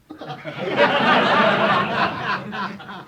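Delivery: high loud burst of laughter. Real, not acted. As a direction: laugh helplessly. Laughing at the dog's name.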